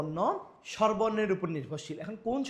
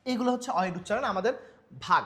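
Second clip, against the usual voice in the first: ordinary talk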